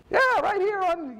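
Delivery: lady matter-of-factly finding the lost father; church lady, casual. Retold or imitated. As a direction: toss it off like it's nothing. Church lady voiced on phone.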